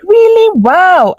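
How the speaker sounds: high-pitched mock astonishment; exaggerated retold surprise; imitated surprise. Retold astonished reaction to news.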